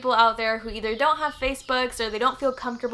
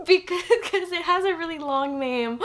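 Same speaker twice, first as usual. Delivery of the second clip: high giggle, teary; giggling at herself, emotional; laughing through tears; 6 semitones higher than usual. Real, not acted.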